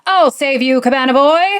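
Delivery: loud mock-heroic proclamation; heroic declaration. A narrator's reading. Neil playfully rescues Greg in the pillow fight, hammed-up hero. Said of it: "strikes a heroic pose, and declares".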